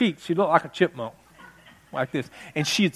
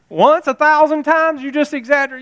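mocking repeat; 9 semitones higher, 4 dB louder than usual. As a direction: throw her own words back at her. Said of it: "mocking mom's words".

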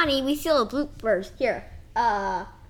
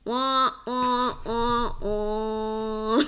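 sad trombone wah; much slower than usual. Acted. Mocking the bad joke.

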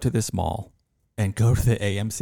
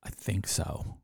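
whispered, unsure mumble; a confused teen clerk whispering uncertainly; 9 dB quieter, slower than usual. Retold or imitated. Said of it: "He goes".

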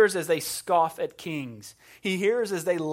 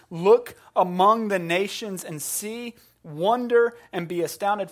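proclaiming God's words; 4 semitones higher, 8 dB louder than usual. Acted. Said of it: "God says this".

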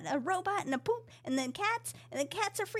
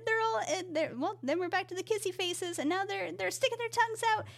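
usual speaking voice

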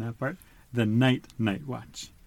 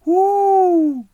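high, loud owl hoot; 11 dB louder than usual. Acted. Owl calls into the dark, high loud hoot. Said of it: "Owl called".

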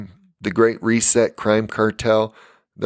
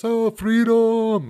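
high, drawn-out battle cry; mock-heroic cry, stretched out without extra volume; William Wallace; 12 semitones higher, much slower than usual. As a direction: wail it long and high like a movie rallying cry. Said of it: "you know, William Wallace".

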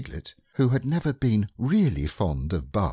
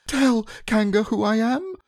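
very high, louder squeaky plea; pleading piglet; 4 dB louder than usual. Acted. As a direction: beg for help.

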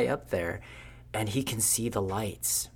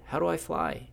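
high-pitched acted question at ordinary volume; mildly urgent plea; 8 semitones higher than usual. Lewis asking the departing firefly how to fly, mildly urgent. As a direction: lightly replay a pleading question.

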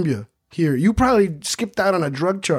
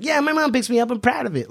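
put-on high, smug kid voice; mock proud classmate; 8 semitones higher than usual. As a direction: brag like a proud little loser. Mimicking loser classmate bragging.

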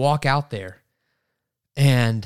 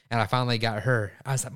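usual speaking voice

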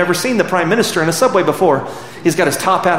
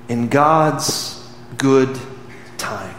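very slow, softer and lower, fading; slow hushed ending; 4 semitones lower, 4 dB quieter, much slower than usual. Acted. Preacher closes the quoted speech, slow and hushed. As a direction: let it sink, slow and soft.

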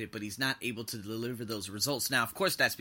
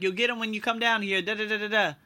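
higher, breezy fobbing-off voice; dad brushing off the kid, pitched up and lilting; dismissive dad; 9 semitones higher, 5 dB louder than usual. Retold or imitated. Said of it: "He's like".

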